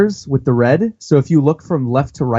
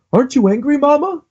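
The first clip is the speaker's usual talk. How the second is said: high, rising little voice; Zeus the cloud; 11 semitones higher than usual. Retold or imitated. Zeus asking Big Mom, high small voice. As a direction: pipe up like a small eager cloud. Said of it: "Zeus says".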